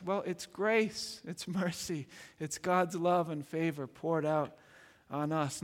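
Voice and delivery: ordinary talk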